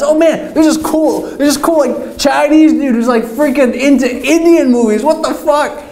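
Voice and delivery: put-on high, excited girl gossiping to friends. Girl telling her friends about the cool guy, high and excited. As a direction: gush like a starstruck college girl.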